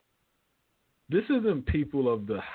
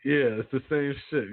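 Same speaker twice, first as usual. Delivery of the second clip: ordinary talk, nothing marked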